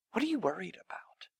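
breathy whispered question. Acted. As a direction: lean in and whisper it. Jesus quoted, intimate question.

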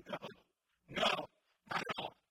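loud, emphatic no; an emphatic denial. Shown as a demonstration. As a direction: slam the answer down. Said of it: "his response is emphatic".

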